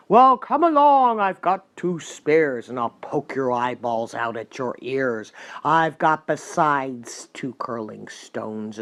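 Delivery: loud, slow, menacing big goat; ugly hoarse goat. A narrator's reading. Big goat dares the troll to fight, loud, slow and hoarse. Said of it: "an ugly hoarse voice of his own".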